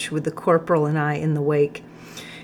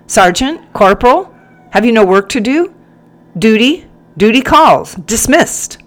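loud, raised officer's bellow; thundering command; 6 semitones higher, 10 dB louder than usual. A narrator's reading. Captain dismissing his sergeant and corporal, thundering. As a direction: thunder it out like a captain giving orders.

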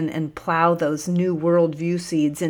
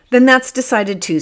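bright and high, much louder; delighted; 8 semitones higher, 8 dB louder than usual. A narrator's reading. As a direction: seal the deal with glee. Sergeant pleased, much higher, louder.

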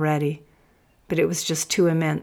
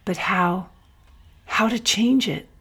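breathy, rising higher on the question; breathed; 4 semitones higher than usual. A narrator's reading. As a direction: ask it on a breath. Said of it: "he breathed".

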